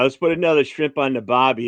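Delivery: Boston-tinged fake Australian accent; attempted Australian accent. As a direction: have a clumsy go at Australian. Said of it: "put on your best Australian accent".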